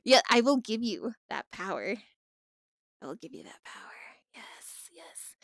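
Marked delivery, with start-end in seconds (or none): 3.0-3.9 low, much softer ominous take on give; hammed-up villain hush; mock ominous murmur. Repeated as dramatic echo. 4.3-5.3 whispered yes, yes like a villain; playful evil whisper; mock sinister whisper. Sinister echo continues.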